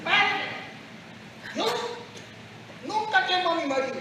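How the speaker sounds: a lightly voiced timid grandmother; old woman confessing. Old woman insisting to the priest she's innocent.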